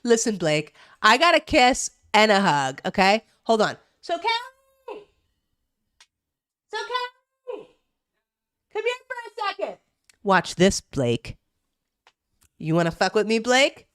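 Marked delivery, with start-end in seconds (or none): 4.0-9.8 high, soft sing-song call to a pet; calling the dog. Real, not acted. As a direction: coax the dog over in a high cooing voice. Calling her dog over.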